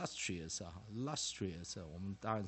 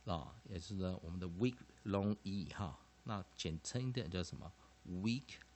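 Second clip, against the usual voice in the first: ordinary talk, nothing marked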